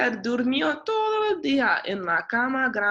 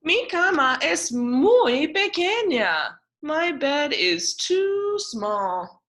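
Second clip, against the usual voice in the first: complaining; 8 semitones higher, 5 dB louder, slower than usual. A narrator's reading. Ted complains, slow.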